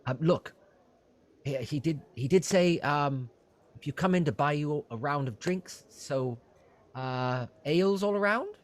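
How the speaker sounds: high, eager innkeeper voice; fussy innkeeper. Acted. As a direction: play a flustered, helpful innkeeper pitched high. Innkeeper offers drinks.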